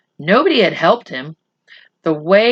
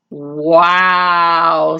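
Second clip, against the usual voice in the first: heavy sarcasm. Acted. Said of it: "said Adil with heavy sarcasm".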